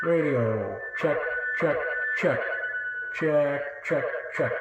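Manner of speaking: tinny metallic echo; radio check through a cheap echo mic; toy echo mic. Real, not acted. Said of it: "too metallic-y sounding".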